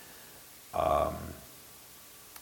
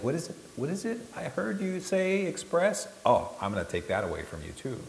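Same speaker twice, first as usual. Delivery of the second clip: much higher, prying mock voice of a petty god; mock killjoy God; 7 semitones higher, faster than usual. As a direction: sneer like a cosmic spoilsport snatching things away.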